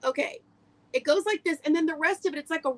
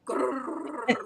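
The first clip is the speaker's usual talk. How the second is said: dog growl imitation; 2 semitones lower than usual. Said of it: "Tippy goes".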